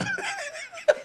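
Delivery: high cackle of a laugh; real amused laugh; high burst of laughter. Real, not acted. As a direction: crack up at the memory. Laughing at his own story.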